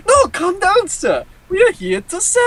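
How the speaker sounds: an irate waiter insisting; irate Italian. Retold or imitated. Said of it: "he's like".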